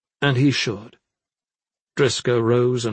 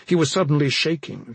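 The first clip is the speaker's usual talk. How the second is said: usual speaking voice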